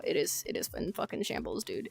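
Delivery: hushed, breathy aside; whispering. Real, not acted. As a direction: whisper it like a secret. Quiet aside about job market.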